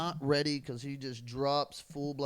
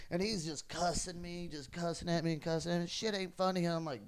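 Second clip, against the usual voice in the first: ordinary talk, nothing marked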